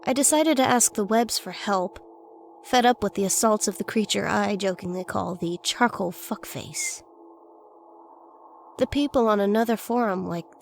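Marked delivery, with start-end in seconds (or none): none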